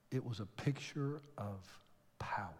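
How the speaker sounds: an awed sermon whisper; hushed whisper. Real, not acted. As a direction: whisper "It was a picture of power" with no voice.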